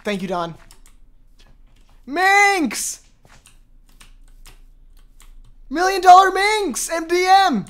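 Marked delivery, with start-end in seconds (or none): 2.0-7.6 high, drawn-out calls for Minx; long searching call. Real, not acted. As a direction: call slowly for someone out of sight. Calling out for missing friend.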